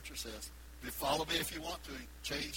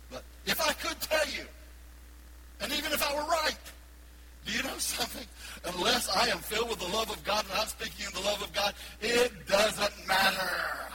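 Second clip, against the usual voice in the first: full-force preaching; 7 semitones higher, 5 dB louder than usual. Real, not acted. Preaching peak.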